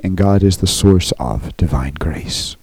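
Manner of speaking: hushed, breathy emphasis; whisper. Real, not acted. Preacher whispering to the congregation about grace. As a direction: drop to a whisper for weight.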